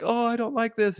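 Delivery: high-pitched mock complaint; unhappy fiancee. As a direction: put on a high, unhappy girlfriend voice. Fiancee's complaint, voiced in retelling.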